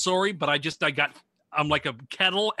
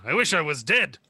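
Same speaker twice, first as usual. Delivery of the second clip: Charles Bronson impression. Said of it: "Oh, like Charles Bronson".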